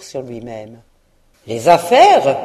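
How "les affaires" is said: loud indignant cry; ghost's outcry. Acted. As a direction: fling the word back, loudly. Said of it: "s'écria le fantôme".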